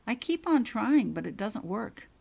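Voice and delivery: put-on anxious girl insisting she tries; earnest pleading. Girl admits to professor she can't block, anxious.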